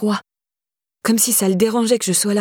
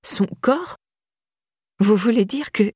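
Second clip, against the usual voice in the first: stunned disbelief; 4 semitones higher than usual. A narrator's reading. Shock at death news.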